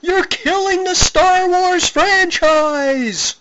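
very high, slow scream. A narrator's reading. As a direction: shriek it while falling away. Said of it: "fell out ... screaming".

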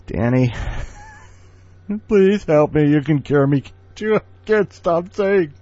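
high, loud pleading through laughter; laughing plea. Real, not acted. A host begging the next contributor Danny to cure him, laughing in a high, loud voice. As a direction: beg for help while cracking up.

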